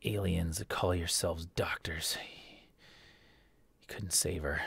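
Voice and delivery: soft, menacing whisper of a threat; wounded governor whispering contempt at the doctor; governor's cold threat. Acted. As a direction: hiss the threat low and quiet. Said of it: "and he says".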